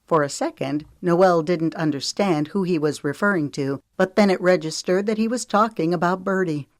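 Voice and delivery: plain reading voice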